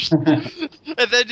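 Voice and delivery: words squeezed out through laughter; laughing. Real, not acted. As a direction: laugh your way through the line. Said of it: "laughs while speaking".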